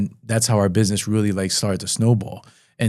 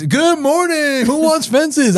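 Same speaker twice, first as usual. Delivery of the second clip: very high, drawn-out goofy greeting; goofy live host; slower than usual. Retold or imitated. Mimicking himself selling fences live on the phone, goofy. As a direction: sing it out like a silly fence pitchman. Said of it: "I'm just the goofiest guy in the world".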